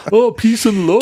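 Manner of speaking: high, hammed-up Liverpool accent. Host teased about his accent, mock Liverpool Beatle voice. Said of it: "You're like [name]".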